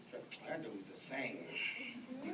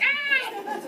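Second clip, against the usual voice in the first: high, loud fake scream; mock horror scream; 13 dB louder than usual. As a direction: let out a comic shriek of horror. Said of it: "Now that's a horror".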